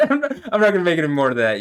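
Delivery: laughing, fast and loud. Real, not acted. Laughing off his own joke to viewers, fast and loud. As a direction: laugh it off and rush on.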